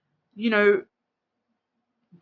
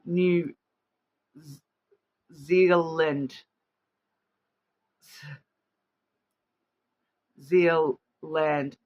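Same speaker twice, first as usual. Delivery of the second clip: halting, deliberate Aussie "New Zealand"; attempted Australian accent; 3 semitones lower, much slower than usual. Shown as a demonstration. Host stopping to think how Aussies say it, careful Australian accent. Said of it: "how do Aussies say it? Aussies say".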